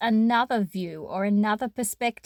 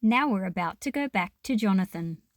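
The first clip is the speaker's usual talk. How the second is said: ordinary delivery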